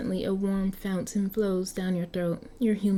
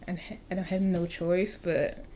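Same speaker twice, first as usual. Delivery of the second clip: ordinary delivery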